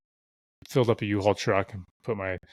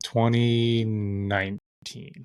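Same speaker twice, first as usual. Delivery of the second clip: drawn out slowly while remembering; slow recall; much slower than usual. Real, not acted. Answering the question, slowly drawn out while recalling. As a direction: stretch each word as you dig up the year.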